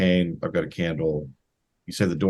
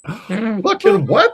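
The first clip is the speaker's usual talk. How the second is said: shocked; 6 dB louder, much slower than usual. Real, not acted. Player reacts to a bad roll.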